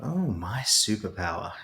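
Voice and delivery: soft embarrassed laugh. Real, not acted. Guest asked his superpower, laughs softly. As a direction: chuckle at the question, quietly.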